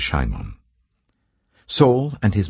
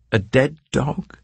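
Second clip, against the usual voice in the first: incredulous; 10 semitones higher, slower than usual. A narrator's reading. David mocks himself before Saul.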